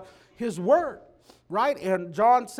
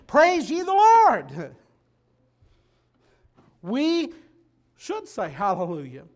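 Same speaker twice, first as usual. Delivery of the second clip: loud, higher, rousing exhortation; preaching at full force; 4 semitones higher, 9 dB louder than usual. Real, not acted. Preacher closing the message, rousing the congregation loudly. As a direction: drive it home to the church.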